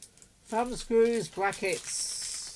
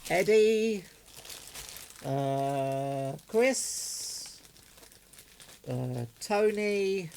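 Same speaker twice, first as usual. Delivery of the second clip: calling out greetings; 3 dB louder, much slower than usual. Real, not acted. Shout-outs to radio listeners.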